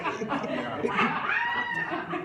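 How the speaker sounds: bursting into laughter; laughing. Real, not acted. Laughing at students' behaviour.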